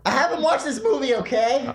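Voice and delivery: high, defensive protest; flustered. Real, not acted. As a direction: squeak it out defensively. Flustered after wrong answer.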